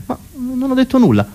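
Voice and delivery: high, protesting mock victim; acted playground protest; cornered schoolkid. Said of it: "ti fermavano a ricreazione".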